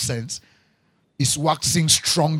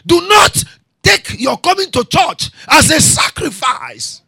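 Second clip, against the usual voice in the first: much higher and louder, slow shouted phrases; shouted preaching climax; 13 semitones higher, 14 dB louder, slower than usual. Acted. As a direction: roar it at the congregation. Climax over music.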